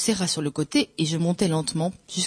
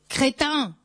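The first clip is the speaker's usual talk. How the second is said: high, contained yell; yelled insult; 8 semitones higher than usual. A narrator's reading. Éponine yelling abuse down the ladder, shouting. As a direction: hurl it as a high-pitched insult. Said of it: "Je l'entendis crier, hurler".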